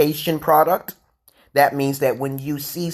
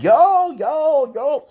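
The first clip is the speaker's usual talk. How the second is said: much higher, hyped yo shouts; excited yo calls; 13 semitones higher, 4 dB louder, much slower than usual. Retold or imitated. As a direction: cheer like impressed ballers. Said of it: "People on the court like".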